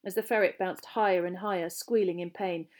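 plain reading voice

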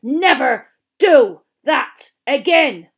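NEVER, DO, THAT, AGAIN each punched separately; very loud, slow "Never do that again", pausing after every word. A narrator's reading. Teacher bouncing the ferret, punching each word of "Never do that again". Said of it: "speaking each word as the ferret hit".

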